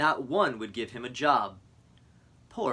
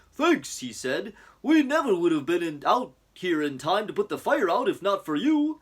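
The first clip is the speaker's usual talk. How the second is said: chief voice; 6 semitones higher, 4 dB louder than usual. Acted. Chief thanks Bullwinkle in story.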